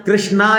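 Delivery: कृष्णा with a stressed, long आ at the end; wrong long aa. Shown as a demonstration. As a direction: mispronounce it as कृष्णा, last vowel wide open. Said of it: "तो गलत उच्चारण हो गया".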